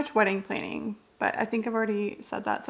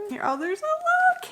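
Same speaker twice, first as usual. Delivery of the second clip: much higher, fast delighted squeal; excited squeal; faster than usual. Real, not acted. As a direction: gush over a surprise. Finds candle in package.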